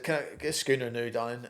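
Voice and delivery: a loud, cheeky Cockney bar order; Cockney lad chatting up the barmaid; Cockney accent. Retold or imitated. At the bar, ordering a schooner in a Cockney accent. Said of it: "I had a real Cockney accent then".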